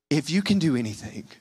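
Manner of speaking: a weak, desperate father's plea; a hint of disappointment in a weak plea. Shown as a demonstration. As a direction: let the words sag with desperation. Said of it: "Do you feel the weakness in that voice?".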